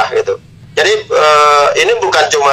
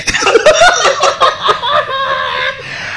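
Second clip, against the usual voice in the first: long high-pitched laughing fit; hearty laugh. Real, not acted. After coach says football talk is always tiring, hearty laugh. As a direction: laugh it out until it tails off.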